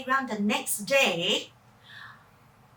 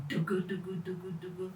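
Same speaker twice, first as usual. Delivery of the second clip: low, quiet engine chant; soft engine hum; 7 semitones lower, 11 dB quieter, faster than usual. Acted.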